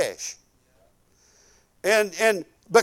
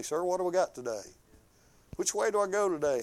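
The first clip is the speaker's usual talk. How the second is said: softer, fast humble sir; respectful request; 3 semitones lower, 11 dB quieter, faster than usual. Retold or imitated. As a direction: ask the boss humbly. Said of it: "No, we go in there and say".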